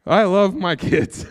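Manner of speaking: laughing at his own joke. Real, not acted.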